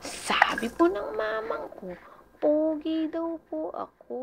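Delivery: slow, loud proud declaration. Pretend. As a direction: announce it loudly and slowly. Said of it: "Sabi ng mama ko".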